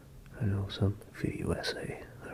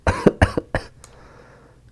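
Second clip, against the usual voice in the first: fake cough; 12 dB louder than usual. Sick pretend, coughing.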